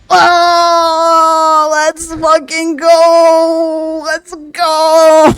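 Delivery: shrieking with joy; loud high celebration; giddy relief. Real, not acted. Finally pulls the five-star.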